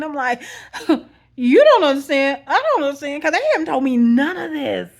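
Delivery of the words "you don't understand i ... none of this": loud, high, emphatic retort; acted-out exasperated disbelief at full volume; incredulous comeback. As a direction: throw the confusion back at the caller. Acts out her incredulous comeback.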